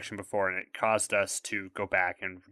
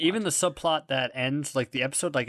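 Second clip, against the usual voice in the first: ordinary talk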